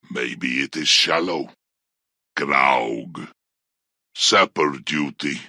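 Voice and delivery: very low, hushed grumble; idle orc muttering. Acted. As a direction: mumble to yourself, deep and under your breath. Orc idly grumbling to self.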